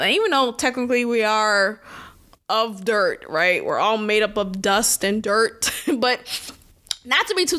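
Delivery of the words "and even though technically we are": ordinary delivery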